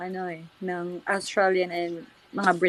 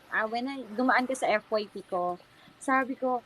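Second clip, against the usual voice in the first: normal talking voice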